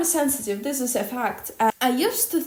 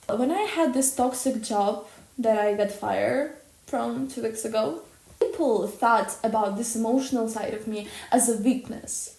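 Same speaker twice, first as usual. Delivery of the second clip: ordinary talk, nothing marked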